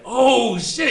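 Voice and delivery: mock surprised exclamation; retold surprise. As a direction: burst out as it suddenly clicks. Far finally recognizes him.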